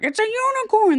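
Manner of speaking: a mad king declaring it proudly, pitched high; crazy king. Retold or imitated. A mad king insists to his courtiers, high and loud.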